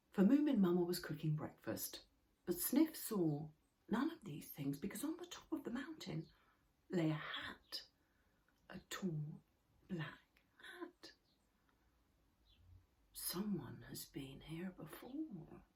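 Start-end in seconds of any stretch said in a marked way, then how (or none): 3.9-11.1 soft, secretive storytelling; hushed mystery. A narrator's reading. Narrator revealing the mysterious hat, hushed. 13.1-15.6 slow, breathy whisper of awe; hushed whisper. A narrator's reading. Snufkin finding the hat on the mountain, slow whisper. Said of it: "said Snufkin".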